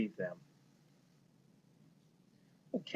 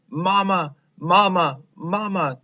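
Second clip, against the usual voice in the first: high, louder panicked calls; frightened cry for mama; 6 semitones higher, 7 dB louder than usual. Acted. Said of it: "they cried".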